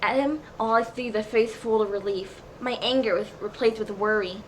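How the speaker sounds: ordinary delivery, nothing marked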